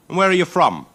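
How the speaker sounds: high, loud suspicious question; Rascally Tag's voice. Acted. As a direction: squint at the stranger. Rascally Tag questions him.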